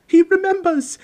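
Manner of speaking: very high, loud, thrilled outburst; excited cry. A narrator's reading. Diggle realises Harry remembers him, crying out thrilled. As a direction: cry out in high-pitched delight. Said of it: "cried Daedalus Diggle".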